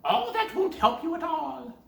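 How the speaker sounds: high loud witch snap; old witch scolds Gretel for weeping; scolding witch. Acted. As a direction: hush the crying girl harshly.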